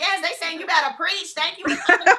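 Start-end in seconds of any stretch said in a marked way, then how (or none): 1.4-2.2 thanking her through a laugh; laughing thanks. Real, not acted. Host thanks the guest for coming up, laughing. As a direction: laugh it out warmly.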